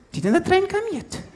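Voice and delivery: high clueless voice; airhead girl. Retold or imitated. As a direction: play her dim and oblivious. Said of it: "she's like".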